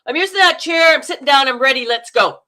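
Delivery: loud, high, forceful audition entrance; brash American auditioner. Acted. American walking into an audition for a role, brash and loud. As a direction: announce yourself like you own the room. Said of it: "this is how an American goes in".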